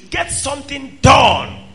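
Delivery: shouted and drawn out; preaching shout. Real, not acted. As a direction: hammer the command home. Preacher rebukes idle congregation.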